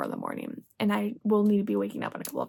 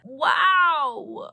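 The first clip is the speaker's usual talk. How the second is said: high, loud, drawn-out wow; big exclamation; 7 semitones higher, 6 dB louder than usual. Real, not acted. Vlogger reacting to her own odd morning, loud exclamation.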